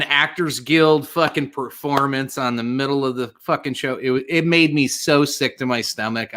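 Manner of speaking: ordinary talk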